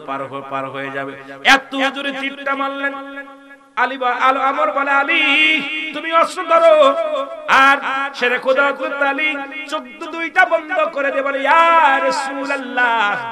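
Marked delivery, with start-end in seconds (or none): 1.4-11.4 high, loud fiery sermon voice; preaching at full force. Acted. Preacher in full force telling story. 11.4-13.3 high, loud, slow drawn-out cry; shouted invocation. Retold or imitated. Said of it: "জোরে জোরে চিল্লায় বলেন".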